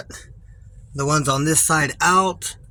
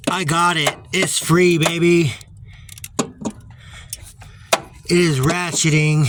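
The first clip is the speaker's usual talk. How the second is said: excited; 2 semitones higher, 4 dB louder than usual. Real, not acted. Bolt finally breaks free.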